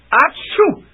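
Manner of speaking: sudden high sneeze; loud sneeze. Acted. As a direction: sneeze it out hard. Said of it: "between paroxysms".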